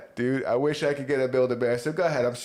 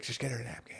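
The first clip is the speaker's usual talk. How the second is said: tired quiet wife; 3 semitones lower, 13 dB quieter, faster than usual. Retold or imitated. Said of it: "She's like".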